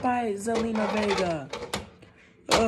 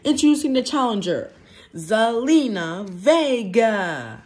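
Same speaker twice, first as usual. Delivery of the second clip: ring announcer; 4 dB louder than usual. Pretend. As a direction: announce the challenger like a wrestling emcee. Ring announcer introduces challenger.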